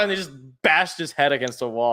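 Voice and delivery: ordinary talk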